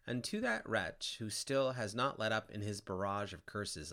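plain reading voice

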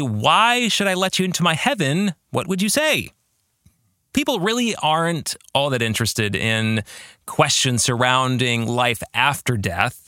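ordinary delivery

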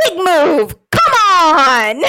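loud, fired-up yell; imitating a driver cheering himself on, loud and pumped; mock race-radio shout. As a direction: hype yourself up over the team radio. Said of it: "over the radio, says".

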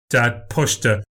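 ordinary delivery

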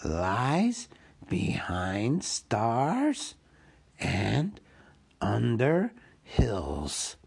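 very slow, drawn-out creature voice; Gollum posing a riddle. Acted. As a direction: draw each phrase out like an eerie riddle.

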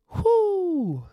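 a high, loud whoop of delight; joyful whoop. Real, not acted. Preacher whooping at the verse to the congregation, loud joyful whoop. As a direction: let out a big joyful whoop. Preacher exults after reading Job.

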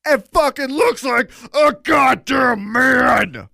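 loud, slow, awed; excited shout. Real, not acted. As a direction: shout it in pure awe. Hyped retelling of Lesnar feat.

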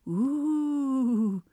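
high eerie ooh; a spooky ghostly ooh. Shown as a demonstration.

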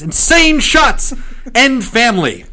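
high, loud newsboy hawking headlines; old-timey paperboy crying the news; newspaper kid. Pretend. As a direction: shout the headline like a street newsie. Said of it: "the newspaper voice".

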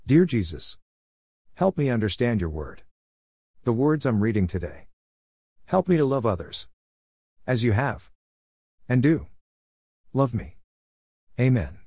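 gentle, earnest prayer voice. Acted. Opening prayer before reading.

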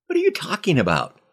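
startled rapid question; baffled. Acted. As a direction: blurt out confused disbelief. Bewildered by friend's accusation.

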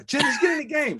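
very high, loud coach yell; impatient scream. Retold or imitated. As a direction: cut in with a high-pitched holler. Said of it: "They're screaming".

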